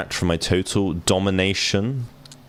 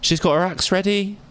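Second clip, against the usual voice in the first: much higher, startled question; alarmed squeak; 13 semitones higher than usual. Real, not acted. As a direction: yelp it in mock alarm. Sees Huntress readying axe.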